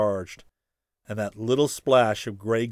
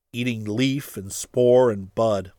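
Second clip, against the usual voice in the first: usual speaking voice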